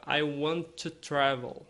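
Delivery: "I want to travel" with the T of "want" joined into "to", slowly; linked T sounds. Shown as a demonstration. Teacher demonstrating connected speech to the class. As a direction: run "want to" together as one T. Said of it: "Esse T eu junto um no outro".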